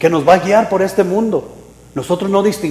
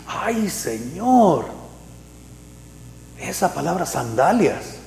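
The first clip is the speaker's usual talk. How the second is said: awed murmur; 7 dB quieter, slower than usual. Retold or imitated. Believer receiving a word.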